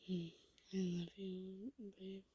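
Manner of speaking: soft, tender bedtime murmur; gentle. Acted. Kissing sleeping Goob goodnight, gentle. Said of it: "you gently kiss him and say".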